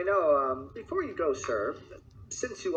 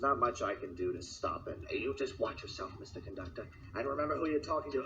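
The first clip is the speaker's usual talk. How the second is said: much softer, menacing low warning; quiet threat; 5 semitones lower, 8 dB quieter than usual. Acted. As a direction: lean in and threaten him softly.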